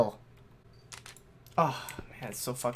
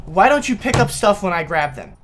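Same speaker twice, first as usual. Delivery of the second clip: loud, high angry rant; side rant; 5 semitones higher, 11 dB louder than usual. Real, not acted. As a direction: vent at the game, loud and high. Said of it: "side rant".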